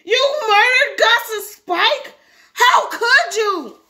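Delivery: very high, drawn-out wailing scream; accusing scream. Acted. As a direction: scream the accusation at your sister. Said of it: "Herbie screamed".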